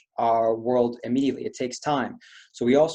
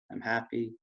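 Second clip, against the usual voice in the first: much softer, hunched and frowning; gloomy; 3 semitones lower, 9 dB quieter than usual. Shown as a demonstration. Coach demonstrates slumped posture, glum and quiet. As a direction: mumble it flat with your head down. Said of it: "In this tone".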